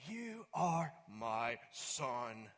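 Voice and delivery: hint of a Charlton Heston God voice, drawn out slowly. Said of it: "a recording of the voice of God".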